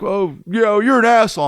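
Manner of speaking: high-pitched imitation of the buddy; imitated reproach. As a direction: call him out as the friend. Older friend's reproach acted out.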